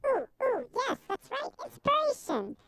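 high, bright excited squeal. Real, not acted. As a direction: gush with delight. Host excited squeal at word.

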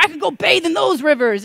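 loud, high, scornful retort; hot-tempered. Acted. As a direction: throw it back as an angry insult. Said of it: "Naaman gets hot".

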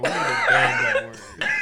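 high, slow words through laughter; laughing. Real, not acted. Host retells his reply to a comment, laughing. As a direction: crack up as you say it.

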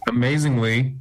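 usual speaking voice

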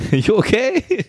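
high, slow, laughing ask; mock concern. Friend asks the fallen woman if she's okay, high and laughing. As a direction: pretend to check on her while laughing.

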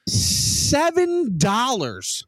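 high, very drawn-out disbelief; incredulous outrage. Real, not acted. Ranter reacting to pizza price, incredulous outrage. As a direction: stretch each word in high-pitched indignation.

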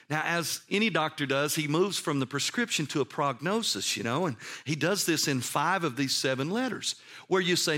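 ordinary talk

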